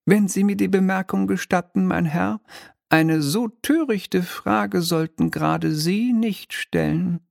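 high, mannered little devil voice; a smug minor demon, lightly shrill and polite; oily demon clerk. Acted. Demon lectures the wizard.

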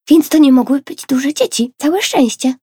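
put-on relieved and pleased child; glad. A narrator's reading. Tosia cieszy się, że to nie duże dzieci, z ulgą. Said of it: "ucieszyła się Tosia".

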